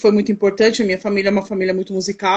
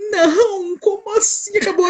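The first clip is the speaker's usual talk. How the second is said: put-on high, crying child's plea; child begging the teacher not to stop; whiny child protest; 9 semitones higher than usual. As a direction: whine like a kid robbed of the story.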